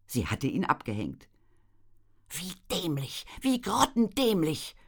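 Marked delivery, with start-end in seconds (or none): 2.3-4.8 mild exasperated self-scolding; scolding herself. A narrator's reading. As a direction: grumble at your own stupidity. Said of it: "schimpfte Clara mit sich".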